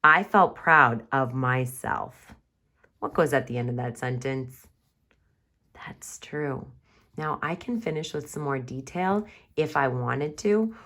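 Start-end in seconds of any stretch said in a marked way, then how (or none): 5.7-6.7 soft unvoiced agreement; quiet aside; whisper. Shown as a demonstration. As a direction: whisper it back. Whispered reply.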